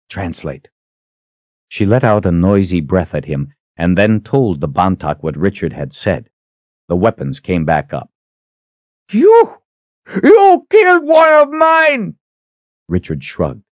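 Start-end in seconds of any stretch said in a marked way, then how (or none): 0.1-0.7 soft command. A narrator's reading. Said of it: "spoke softly to her". 9.1-12.1 high, loud, slow outraged cry; outraged shout. A narrator's reading. Shaman outraged at confession.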